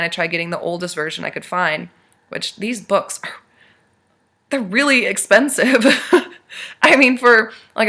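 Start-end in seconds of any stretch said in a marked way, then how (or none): 4.5-7.9 higher and louder, breaking into a laugh; laughing at the price. Real, not acted. Telling her viewers about thousand-dollar books, laughing.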